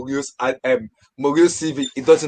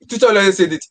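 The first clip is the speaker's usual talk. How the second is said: ordinary delivery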